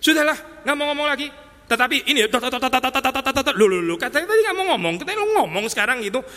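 playing a moody Jesus giving up on them. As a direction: sulk then catch yourself talking. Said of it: "Mood swing dong kayak gini Yesus".

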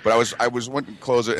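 normal talking voice

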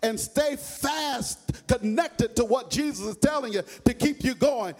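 sung, rising preacher's whoop; whooping Baptist preacher climax; preaching at full force. Acted. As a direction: chant it out over the music, loud and high.

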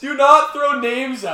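loud, high, slow scolding; indignant protest. Real, not acted. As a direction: lay down the rule loudly and slowly. Objects to names being read out.

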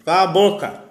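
sudden yell at the dog; shouting. Real, not acted. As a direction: bark the order out loud. Yelling at the barking dog.

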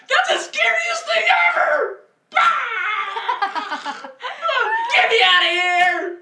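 high, loud panicked shouting; terrified scream. Acted. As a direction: scream like in a horror movie. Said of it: "That was really good".

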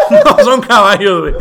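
cracking up while repeating it; laughing. Real, not acted.

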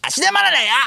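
put-on very high, crude child-star caricature; 芦田愛菜. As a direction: squeak it out as a fake little girl. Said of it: "芦田愛菜ちゃん。". (芦田愛菜のものまねのものまね、甲高く)

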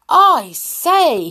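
high, loud astonished cry; amazed exclamation. Acted. Mister Nonsense seeing the snow, amazed. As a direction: gasp it out in wonder.

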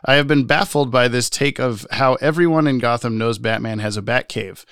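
usual speaking voice

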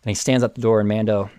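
normal talking voice

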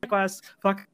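high, loud put-on voice; mock rude shout. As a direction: blurt it out rudely. Acted rude quote from film.

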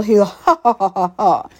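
clipped laugh through gritted teeth; imitating his laugh. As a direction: mimic an annoying teeth-clenched chuckle. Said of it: "he would grit his teeth together when he laughed".

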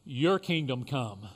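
leading the Lord's Prayer with long pauses; short phrases with pauses. Real, not acted. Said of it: "I will say a phrase".

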